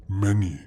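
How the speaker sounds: Many hit loud, with a falling tone; emphatic boast. Acted. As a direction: punch the word Many. Dragon boasting of treasure.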